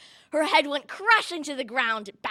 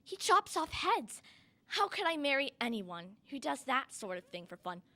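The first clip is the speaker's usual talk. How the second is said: soft, dismayed inner voice; thought to herself; 8 dB quieter than usual. A narrator's reading. Cinderella doubting she could marry the prince, thought softly. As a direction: wonder it softly to yourself. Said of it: "she thought".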